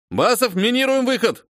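high urgent shouted order; shouting orders over battle. A narrator's reading. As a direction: snap the order mid-fight. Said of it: "сержант командует". (срочный выкрик сержанта)

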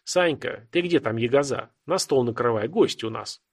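ordinary delivery

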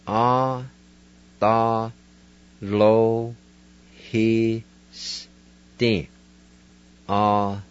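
"Ah, tha, lo, he, stin" spaced out, then run together; syllable by syllable. Shown as a demonstration. Teacher to Cherokee learners, modelling the word win. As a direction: sound out "Ah tha lo he stin", leaning on "lo". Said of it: "go back and pronounce these words".

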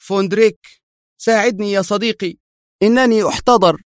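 high, desperate plea; pleading. A narrator's reading. Wounded Henrik begging Vondrik for help, pleading. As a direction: beg a friend for help while dying. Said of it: "فهتف به في ضراعة".

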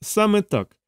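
high, needling retort; snide. Acted. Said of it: "єхидно заперечив майор".

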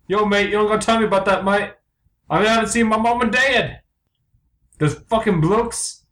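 high English bloke; unconvincing English accent. Acted. Blue commiserates over absent parents, high English accent. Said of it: "very Englishmen".

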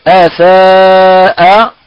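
أساء drawn out slowly with a light hamza; correct recitation. Shown as a demonstration. Teacher repeating the right أساء after rejecting the wrong one. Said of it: "لا".

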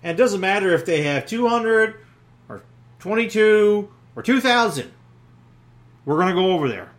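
a hint of a brave leader; bold Jonathan. Jonathan rallies his young armor bearer, boldly. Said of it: "Jonathan tells his armor bearer".

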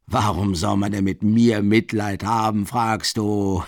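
loud, rapturous outcry with a laugh; ecstatic cry. A narrator's reading. Marmeladov answers the innkeeper's jeer, loud and ecstatic, laughing. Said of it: "in unverkennbarer Ekstase".

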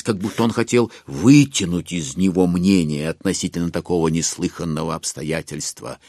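ordinary talk

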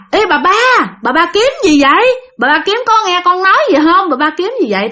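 acted hail to someone not answering; calling out. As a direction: call out to get a neighbour's attention. Calling to figure in ditch.

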